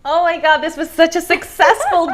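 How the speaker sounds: normal talking voice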